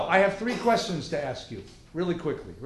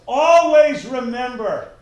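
loud, drawn-out higher proclamation; emphatic recitation; 7 semitones higher, 11 dB louder, slower than usual. Acted. Teacher reciting Torah to students, emphatic proclamation. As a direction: proclaim it slowly and loudly.

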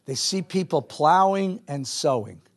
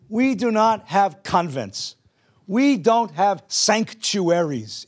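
slow, emphatic sermon cadence; preaching; 5 semitones higher, 3 dB louder than usual. Acted. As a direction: build the charge to the congregation. Preaching climax to congregation.

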